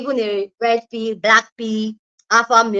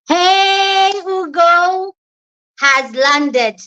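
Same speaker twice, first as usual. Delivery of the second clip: excited; 8 semitones higher, 4 dB louder, much slower than usual. Real, not acted. Greeting a viewer joining the stream.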